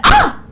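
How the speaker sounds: very high, loud "ah!"; mock eureka yelp; sudden idea exclamation. Dude suddenly has the idea.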